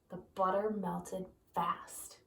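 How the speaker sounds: slow hushed whisper. Acted. Reader hushing the children mid-story, slow whisper. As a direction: breathe the words out slowly. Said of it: "whispered read aside".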